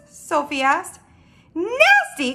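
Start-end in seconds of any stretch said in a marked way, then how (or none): 1.6-2.3 high, loud outraged exclamation; scandalized, pitched-up outburst; indignant cry. Acted. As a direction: cry it out in offended disbelief. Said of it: "cried the B F G".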